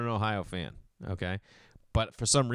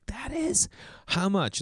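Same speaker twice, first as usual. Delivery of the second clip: surprised; 9 semitones higher, slower than usual. Retold or imitated. Customer shocked at coral.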